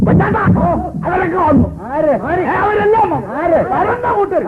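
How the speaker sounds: put-on high, breathless boy's voice; high-pitched boy announcing the arrivals; excited boy. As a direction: blurt it out like a boy who ran in. Boy runs in announcing guests.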